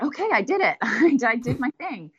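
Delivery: high, light, giggly self-talk; pleased little note to self, half laughing; self-encouraging. Retold or imitated. Recalling her pleased self-talk.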